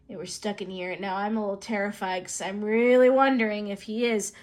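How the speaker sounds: normal talking voice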